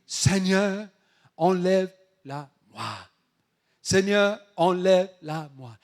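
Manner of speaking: loud, deliberate plea in short bursts; fervent prayer. Acted. Paul praying to the Lord to remove the thorn, fervent. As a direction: call it out firmly, phrase by phrase. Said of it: "il a prié".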